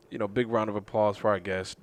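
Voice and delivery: ordinary talk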